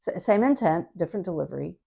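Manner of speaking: ordinary delivery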